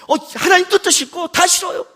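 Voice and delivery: high-pitched mock tantrum; petulant retort. Husband snapping back at his soothing wife, petulant. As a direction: refuse it all, pitched high.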